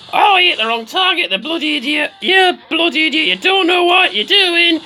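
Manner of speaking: high, loud, exasperated rant; mock furious scolding. Mock scolding of the game character.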